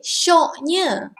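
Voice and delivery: admiring exclamation; complimenting. Shown as a demonstration. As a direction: praise a friend's new car. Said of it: "syoknya here means like you are complimenting".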